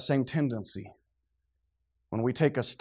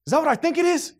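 much higher, startled question as fear sets in; dawning panic; 12 semitones higher, 4 dB louder, faster than usual. Retold or imitated. Man in line realises what rolled, rising panic. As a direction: voice jumps as the horror dawns. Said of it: "what would you be thinking".

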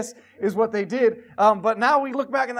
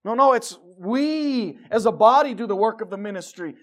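full-voiced sermon preaching; 7 dB louder than usual. Real, not acted. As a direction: preach it to the congregation. Preaching in church.

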